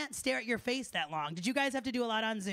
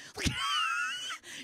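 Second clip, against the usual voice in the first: very high, squealing outburst. Real, not acted. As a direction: let out a shrill, drawn-out comic squeal. Comic reaction to going deaf.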